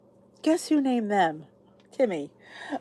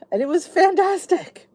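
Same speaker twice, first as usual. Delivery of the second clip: excited; 10 semitones higher, 5 dB louder than usual. Real, not acted. Raving about her pasta dinner.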